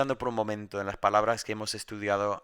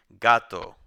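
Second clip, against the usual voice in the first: Gato said alone and loud with the stress on GA; teacher holding up an example of a llana word; 2 semitones lower, 8 dB louder than usual. Shown as a demonstration. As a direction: give Gato as a clear example, leaning on GA. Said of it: "el acento cae en la penúltima".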